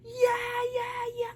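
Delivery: high-pitched drawn-out whoop; mock audience cheer. Host imagines people standing up for a showstopper, mock cheering. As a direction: imitate a crowd leaping up to cheer. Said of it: "get up and be like".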